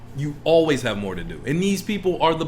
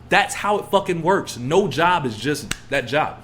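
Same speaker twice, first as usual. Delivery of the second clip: ordinary talk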